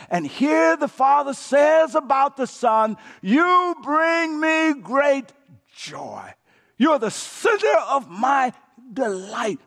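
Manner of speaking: loud, high, slow proclamation; preaching shout. Real, not acted. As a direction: belt it out from the pulpit.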